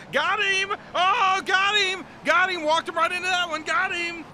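high, loud victory yells; real gamer celebration shouts; triumphant shouting. Real, not acted. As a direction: crow over the kill. Celebrating a kill in game.

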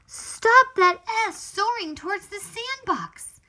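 high, loud call to stop it. Acted. Reader calling out to stop the S. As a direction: shout a warning.

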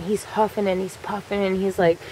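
plain reading voice

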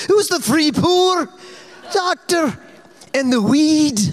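very high, pleading Scottish woman; Scottish lilt, high and insistent; imitating Ruth's Scottish to the doctor; Scottish accent. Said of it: "she turned on her full Scottish for this doctor".

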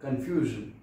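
zh in कन्फ्यूजन; zh sound. Shown as a demonstration. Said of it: "इसे पढ़ेंगे कन्फ्यूजन".

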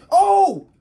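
sudden loud high "Oh!"; startled high cry. Real, not acted. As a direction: jump at the mouse's move. Mouse finally moves.

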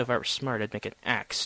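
usual speaking voice

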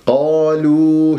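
held, drawn-out long vowels; natural madd. Shown as a demonstration. Reciter showing students the two-count madd, slowly drawn out. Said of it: "ما الطبيعي حركتين".

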